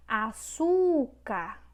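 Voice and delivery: "Açúcar" with a long, drawn-out ÇÚ; slow "Açúcar" right down, leaning on ÇÚ; stretched stressed syllable. Shown as a demonstration. Teacher showing students the tonic syllable, stretched stress. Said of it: "sílaba tônica, que é o su".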